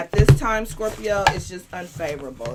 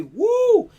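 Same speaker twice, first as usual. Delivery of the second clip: loud high woo; excited whoop; 14 semitones higher, 8 dB louder than usual. Real, not acted. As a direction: cheer it out. Celebratory shout.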